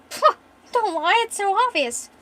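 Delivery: high, loud dismissive scoff; teasing scoff. Acted. Jasper scoffs at their denial.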